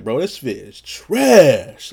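loud, slow, drawn out on trash; mock disgust. Telling a bro his fit is bad, loudly appalled. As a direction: stretch the last word out in exaggerated disdain. Acted disgust at a friend's outfit.

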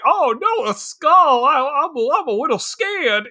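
high-pitched, hammed-up fright; mock fear. Man finding a skull, mock scared. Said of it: "a deathly fear of skeletons".

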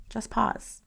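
a hushed, gentle second "Just pause."; soft repeat. Shown as a demonstration. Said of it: "I want you just to pause".

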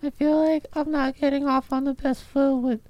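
put-on low, soft drunken mumble; drunk mumbling. Drunk gnome mumbling sadly.

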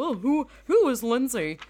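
mock intro. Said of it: "my whole".

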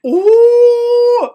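a shriek of delight; squealing ooh. Real, not acted. A host squealing at her co-host's joke about a drowned rat.

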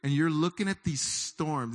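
usual speaking voice

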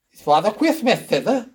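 lisped CHRISTMAS, s sounds turned to th; high little-girl voice lisping "Father Christmas, thither"; child's lisp. Acted. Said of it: "how exactly do you work the lisping".

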